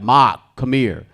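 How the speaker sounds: coach calling out. Retold or imitated. Coach calls young Mark over, slow loud call. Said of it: "The coach was like".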